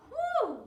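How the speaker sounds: high, loud whew of relief; relieved whew. Acted. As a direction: blow out a big whew. Bear relieved, read aloud.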